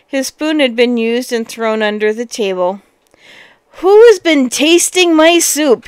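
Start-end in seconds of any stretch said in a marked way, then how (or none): 3.8-5.9 loud, indignant big bear cry. A narrator's reading. Cross bear finding his spoon used, big bear voice. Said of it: "he cried in a big bear voice".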